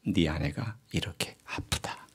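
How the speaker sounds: low, slow whisper; hushed inner voice. Retold or imitated. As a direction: murmur it slowly as God's voice in the heart. 하나님의 음성을 마음속에서 듣는 장면. (낮고 느리게 속삭이는 음성)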